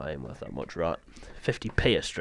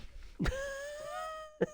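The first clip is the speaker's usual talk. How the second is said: weary laugh; 10 dB quieter than usual. Real, not acted. Tired from tech issues.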